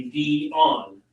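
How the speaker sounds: "Dion" stretched slowly as dee-ON; stress on second syllable. Shown as a demonstration. Husband tries his wife's name after a viewer's tip, second syllable stressed. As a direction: split "Dion" slowly, leaning on the ON. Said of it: "Emphasis on the second syllable".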